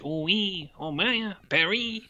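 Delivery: a hint of a French villain, hammed up; mock French accent. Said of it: "he's talking with a French accent".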